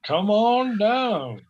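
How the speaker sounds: high, drawn-out contestant call; game show announcer. Acted. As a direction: call a contestant down with long, rising vowels. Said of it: "on The Price is Right or something".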